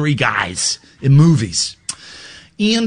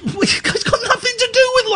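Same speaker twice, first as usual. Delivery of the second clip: laughing while ranting, voice climbing high. Real, not acted. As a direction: laugh through the outrage. Laughing incredulous rant.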